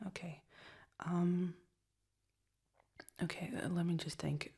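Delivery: soft, caring whisper for a migraine. Real, not acted. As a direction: whisper gently to a sick partner. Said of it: "that's soft voices".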